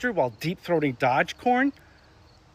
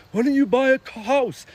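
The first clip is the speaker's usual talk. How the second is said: pitched much higher and louder, a nagging boomer voice; mock boomer asking; 9 semitones higher, 4 dB louder than usual. Comedian mocks boomers nagging millennials, high and loud. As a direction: needle them like a smug boomer parent. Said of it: "they love asking".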